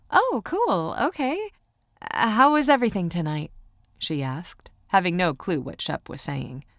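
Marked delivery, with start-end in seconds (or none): 0.1-3.5 high-pitched waitress voice; polite, pitched-up customer-service voice; clueless chirpy server. A narrator's reading. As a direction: cover confusion with a high, quick check-in. Server awkwardly covers her confusion.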